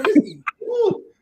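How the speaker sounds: high-pitched outraged yell. Retold or imitated. Venting to the co-host about the coach, outraged high yell. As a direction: blow up at the news. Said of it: "don't understand how upset I am".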